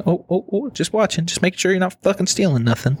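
high, nosy mock lady voice; mocking the checkout lady. Mimicking the self-checkout lady watching him, high nosy mock voice. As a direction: needle her like a suspicious clerk.

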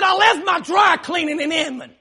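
acted frustration. Husband answering wife after slamming car door, acted frustration. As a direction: snap it out, reenacting the frustration. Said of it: "I'm already frustrated now".

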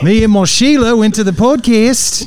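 loud, high, hammed-up Australian; bad Australian accent. Shown as a demonstration. Co-host bragging about a Sheila, exaggerated loud Australian accent. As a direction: belt out a cartoon Australian accent. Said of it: "We're gonna get cancelled by Australia".

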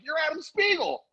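high-pitched excited exclamation; excited recognition. Retold or imitated. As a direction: reenact the thrill of recognizing an old classmate. Retold excited recognition of old classmate.

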